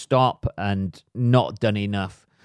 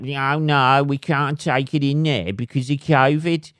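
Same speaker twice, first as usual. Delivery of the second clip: mocking delivery men; 5 semitones higher, 4 dB louder than usual. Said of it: "the people turn up".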